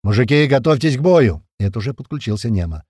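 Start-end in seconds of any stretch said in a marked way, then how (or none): 0.0-1.4 loud, rallying shout; battle call. A narrator's reading. Fighter alerting comrades over radio, battle call. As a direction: call the men to arms.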